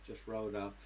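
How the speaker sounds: ordinary delivery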